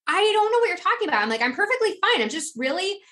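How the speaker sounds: high, quick self-defense; passionate protest. Retold or imitated. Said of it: "just really just talking passionately".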